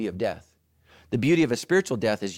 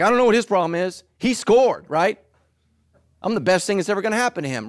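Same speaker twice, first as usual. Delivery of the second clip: fast, loud, high, self-satisfied; smug wife; 8 semitones higher, 7 dB louder than usual. Retold or imitated. Wife who isn't trying, boasting about her husband, breezy and smug. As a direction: toss it off like she's the prize. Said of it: "they're like".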